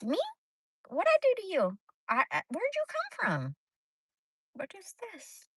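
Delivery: high, incredulous squeak; retold stunned disbelief; shocked disbelief. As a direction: act baffled and caught off guard. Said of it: "And I'm like".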